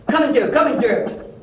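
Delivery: high, loud warning to clear a path; urgent calling out. Acted. Detective rushing after John through the crowd, calling out. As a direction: shout your way through a crowd.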